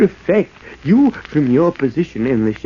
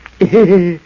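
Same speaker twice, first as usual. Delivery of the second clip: mad cackle; 5 dB louder than usual. Acted. Madman laughs at voice.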